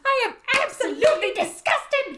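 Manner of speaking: high, shrieking scolding mother; Molly Weasley howler. Pretend. Molly shrieking at Ron over the stolen car, high furious scolding. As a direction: screech it like a furious mum's letter. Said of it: "fun to say".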